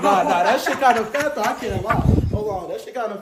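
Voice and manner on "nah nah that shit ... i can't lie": loud laughing admiration. Real, not acted. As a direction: laugh it out, impressed. Admiring her after looking.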